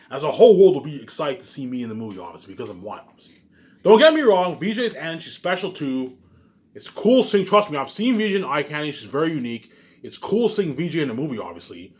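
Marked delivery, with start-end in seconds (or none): none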